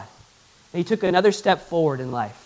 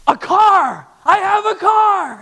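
high, loud, drawn-out cry of glee; imitated overjoyed shout; 12 semitones higher, 7 dB louder, slower than usual. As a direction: shout it like a man wild over his present. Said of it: "and he's all excited".

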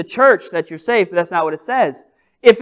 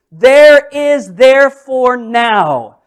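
"There is therefore now" said slow and loud, each word weighted; emphatic verse quote; 4 semitones higher, 8 dB louder, much slower than usual. Acted. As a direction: lay the verse down heavily, word by word. Preacher quotes key verse slowly.

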